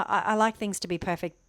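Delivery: ordinary talk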